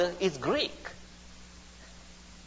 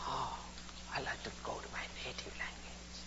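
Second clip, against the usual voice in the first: hushed, quick, barely voiced sigh of prayer; hushed agony; 6 semitones lower, 18 dB quieter, faster than usual. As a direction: drop to an anguished whisper. Describing agonised, fervent prayer.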